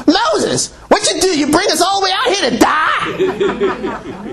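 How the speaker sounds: high, whiny complaining crowd; whining Israelites. Retold or imitated. Preacher mocking the Israelites complaining to Moses, whiny. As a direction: whine it out like grumbling people. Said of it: "they start complaining and whining".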